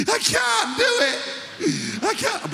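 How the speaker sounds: squealed, very high and breathless; hammed-up wheezy squeal, drawn out; high-pitched, out of breath. Exhausted player pleading he can't run, squealing breathlessly. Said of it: "he got so high-pitched and out of breath".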